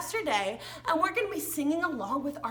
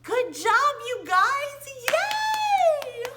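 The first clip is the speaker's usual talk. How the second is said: much higher, louder praise; cheering; 11 semitones higher, 6 dB louder, slower than usual. Acted. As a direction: cheer the kids on. Cheering the children.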